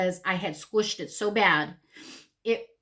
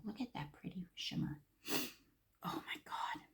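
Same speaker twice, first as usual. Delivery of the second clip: soft whispered aside; hushed whisper, marveling at the makeup; whispered admiration; 17 dB quieter than usual. Real, not acted. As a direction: breathe it out in awe.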